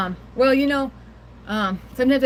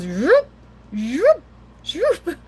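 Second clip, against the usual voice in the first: "Zoop" repeated, rising higher and louder; imitated gulping noise; 7 semitones higher, 4 dB louder, much slower than usual. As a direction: mimic a cat shoveling food, zoop. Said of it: "he goes".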